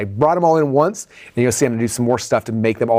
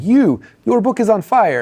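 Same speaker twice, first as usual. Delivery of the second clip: high, slow, badly faked Jamaican accent; horrible Jamaican accent; 9 semitones higher, slower than usual. Concession stand lady recognising him, bad Jamaican accent. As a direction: attempt a Jamaican lilt and botch it. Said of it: "That was not a Jamaican accent. That was horrible".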